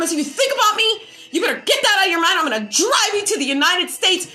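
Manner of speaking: high, loud shouted threat; retold screaming. Threatening the stalker to leave, high and loud. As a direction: yell it at him full force. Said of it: "I was just screaming".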